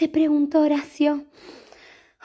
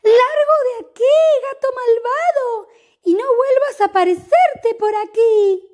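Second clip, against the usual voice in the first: shrill furious shouting; angry woman; 11 semitones higher, 7 dB louder than usual. Acted. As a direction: chase him off in a rage. Woman chasing cat away.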